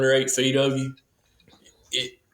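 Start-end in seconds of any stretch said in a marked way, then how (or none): none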